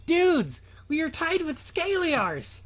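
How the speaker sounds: a lightly voiced cheering kid; [name] voice. [name] calls to his house, lightly voiced excited kid.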